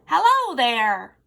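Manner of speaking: slow, loud booming hello. Acted. Effie greets a butterfly with a booming hello. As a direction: boom it like thunder. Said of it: "Effie boomed".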